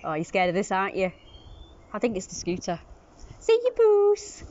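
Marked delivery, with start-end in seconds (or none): 3.4-4.4 much higher sing-song farewell; high goodbye to cat. Real, not acted. Owner trills goodbye to the cat. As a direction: trill a bye to the kitty.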